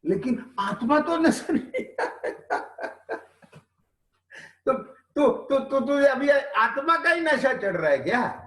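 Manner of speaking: lightly mock the eager student; mock disciple.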